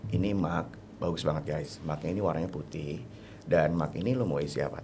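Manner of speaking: very low, soft monotone sales pitch; sleep-inducing, lifeless monotone; no intonation. Shown as a demonstration. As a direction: drone through the pitch without any melody. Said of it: "Tapi gue nggak ada intonasi".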